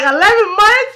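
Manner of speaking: high, loud shocked question; imitating an astonished host; incredulous echo. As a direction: repeat it in disbelief, pitch shooting up. Host reacts in shock to answer.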